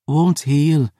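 high, pained female voice; wounded woman. Acted. Wounded Phoebe tells her friend, pained and high. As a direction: force it out through pain.

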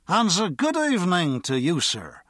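put-on wobbly, over-polite drunk bowing to the guard; drunken Irishman. A narrator's reading. Drunk greets the station guard with a wobbly bow, tipsy and over-polite. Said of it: "the drunk said".

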